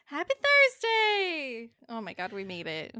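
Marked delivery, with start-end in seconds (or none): 0.1-1.7 loud, high, drawn-out sing-song; cheery greeting. Real, not acted.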